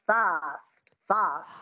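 "fast" with a long ah vowel; broad A. Shown as a demonstration. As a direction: open "fast" out to fahst. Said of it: "just make it fast".

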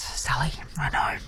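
rueful hushed whisper. Real, not acted. Host to texter Sally, whispering sympathy. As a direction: breathe it like a confession.

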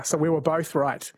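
usual speaking voice

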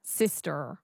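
SISTER with the final R sounded, low and soft; American rhotic R. Shown as a demonstration. Student echoing coach, sister with American R. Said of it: "So that's the American".

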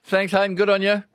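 high, loud, beaming thanks; cheery send-off. Real, not acted. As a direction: send him off with big enthusiasm. Host cheerfully farewells the caller.

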